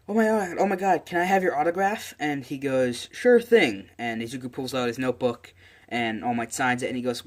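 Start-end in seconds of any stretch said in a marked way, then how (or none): 0.1-2.1 high-pitched, gushing autograph request; excited fanboy. Acted. Young fan runs up to his hero for an autograph, excited. 3.1-3.9 loud, high-pitched cheerful agreement; hearty hero. Acted. Hero agrees to sign a fan's autograph, loud and hearty.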